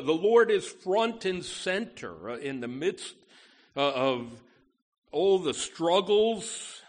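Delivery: ordinary talk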